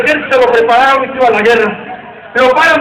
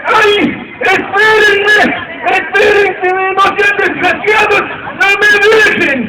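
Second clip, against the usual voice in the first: put-on high, slow, drawn-out wailing old man; desperate old man; 8 semitones higher, much slower than usual. Old man left on the dock, wailing high and slow. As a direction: stretch each cry like a frantic old man left behind.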